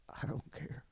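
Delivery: whispered. Retold or imitated. Telling drunk Peter he's going in, whispered. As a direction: whisper I don't care. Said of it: "And I said".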